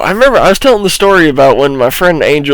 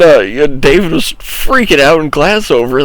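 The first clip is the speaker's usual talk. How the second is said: normal talking voice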